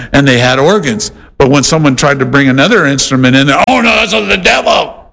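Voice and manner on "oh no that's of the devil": mock alarmed outcry. Churchgoer rejecting a new instrument, mock alarmed and higher. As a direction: cry out in fake horror.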